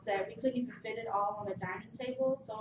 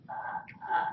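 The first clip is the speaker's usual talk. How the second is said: whispered strangled gasps; choking. Retold or imitated. Girl who can barely breathe, whispering choked sounds. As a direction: try to talk with a squeezed throat.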